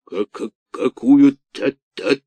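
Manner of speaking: slow, fearful stammer. A narrator's reading. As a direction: stutter out of terror. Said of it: "спросил он, заикаясь".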